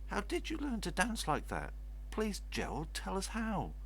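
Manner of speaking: high, eager begging; eager pleading. Acted. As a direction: beg him excitedly. Crowd begs Gerald.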